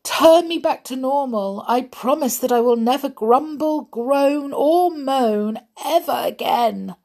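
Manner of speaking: high, urgent pleading; desperate storybook promise; begging. A narrator's reading. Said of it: "begged Mister Grumble".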